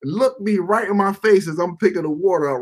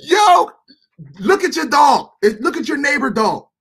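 excited shout; 8 semitones higher than usual. Real, not acted. Ranting that dogs aren't real.

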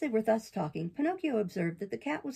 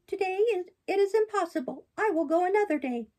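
high, bright-eyed puppet, much higher than the narrator; puppet boy voice; 11 semitones higher, 5 dB louder than usual. Acted. Pinocchio protests to the fairy in a high boyish voice. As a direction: play Pinocchio as an eager young boy defending himself.

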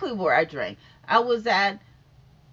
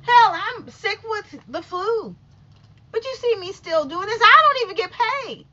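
fired-up rant; 7 semitones higher, 6 dB louder than usual. Real, not acted. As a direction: rant with full force. Fired-up rant about flu.

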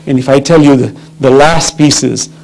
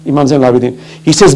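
ordinary talk

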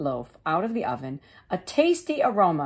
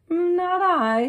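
a high, bored refusal; high lazy dog; 12 semitones higher, 4 dB louder than usual. Acted. The dog turning down the hen, lazily. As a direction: toss off a lazy no.

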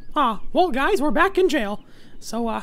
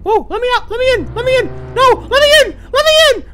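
desperate yelling; 7 semitones higher, 7 dB louder than usual. Real, not acted. Locked out, pleading loudly.

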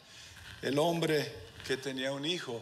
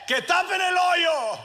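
put-on high, loud, slow yell of a running boy; kid's shout; 14 semitones higher, 11 dB louder than usual. Little boy running up to the gravediggers, shouting high and loud.

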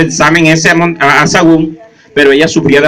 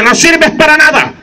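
scornful wife; 10 semitones higher, slower than usual. Acted. Wife voiced in story.

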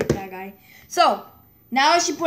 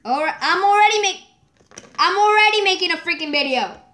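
fast, higher-pitched irritated complaint; exasperated protest; 6 semitones higher, 4 dB louder, faster than usual. Real, not acted. Telling the caller about the video, exasperated. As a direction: protest quickly, fed up with interruptions.

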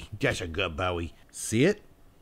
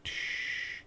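long soft shushing hiss; 10 dB quieter than usual. Shown as a demonstration. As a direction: hush the dog with a gentle shh. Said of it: "I want it quieter".

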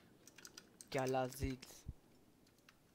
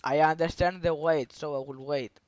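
loud broken English in a put-on Arabic accent; fake Arabic accent; 10 dB louder than usual. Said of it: "ότι τρολάρω αυτός τώρα". (ψεύτικη αραβική προφορά, δυνατά)

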